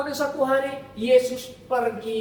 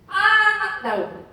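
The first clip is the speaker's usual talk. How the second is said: calling out; 6 semitones higher, 4 dB louder, much slower than usual. Acted. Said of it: "Dia panggil".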